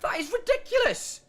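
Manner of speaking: high, disbelieving yell; excited shout. Real, not acted. Caster reacts to escape.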